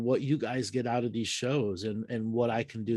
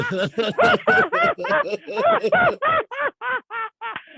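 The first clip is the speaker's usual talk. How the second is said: long high burst of laughter; big laugh; 11 dB louder than usual. Real, not acted. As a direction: laugh out loud, delighted. Reacting to caller's joke.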